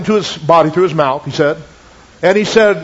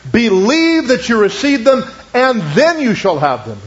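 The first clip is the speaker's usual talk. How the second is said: slow, with weight on "believe" and "then"; emphatic verse reading; 4 semitones higher, slower than usual. Retold or imitated. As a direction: stretch out "believe", then set "then" apart. Teaching the order in a verse.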